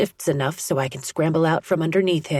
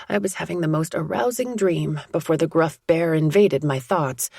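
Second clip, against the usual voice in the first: ordinary delivery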